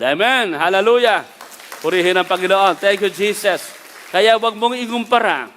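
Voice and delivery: loud, rising preacher's call to the congregation; full-force preaching. Acted. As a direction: preach it out, lifting the crowd. (malakas na pangangaral sa kongregasyon)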